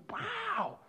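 high, soft gasp of wonder; breathy awed wow. Retold or imitated. Preacher describing awe taking over, high hushed wonder.

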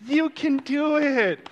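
high-pitched, drawn-out encouragement; cheering race worker. Acted. Race worker cheering on a spent runner, high and stretched out. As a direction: urge them on in a put-on high voice. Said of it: "one of the workers, and they're like".

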